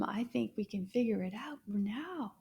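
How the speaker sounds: usual speaking voice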